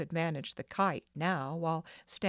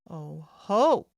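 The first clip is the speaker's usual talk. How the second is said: loud, delighted "oh ho"; a boy whooping with joy; joyful cry; 10 dB louder than usual. A narrator's reading. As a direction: crow it out with glee. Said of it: "cried Reginald joyfully".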